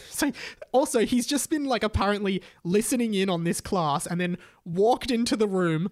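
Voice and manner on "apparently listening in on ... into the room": ordinary delivery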